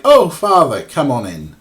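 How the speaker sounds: high, loud exclamation easing into a welcome; fooled old woman. Retold or imitated. Old woman welcoming fake priest, delighted greeting. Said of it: "And she's just like".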